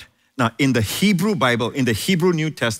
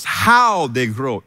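HOW stretched long and strong; drawn-out, emphatic "how they grow"; stress on HOW; 3 dB louder, much slower than usual. Shown as a demonstration. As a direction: lean hard and slowly on "how". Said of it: "Notice the emphasis".

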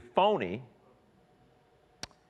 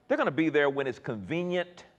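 usual speaking voice

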